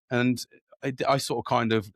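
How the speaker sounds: ordinary delivery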